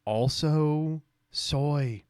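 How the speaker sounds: very slow, stretched-out words; long, dragged mock verdict; drawn-out mocking. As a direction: draw out both words mockingly. Mocks the post, says it twice.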